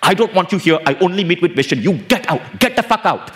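high, heated demand to leave; angry guru. Retold or imitated. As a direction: throw the employee out like a raging guru. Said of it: "And the guy's like".

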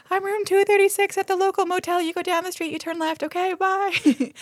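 high put-on voice, a mild mock shout; shouting creepy suitor. Gare calling his motel room after Lillian, high-pitched. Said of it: "is shouting at her".

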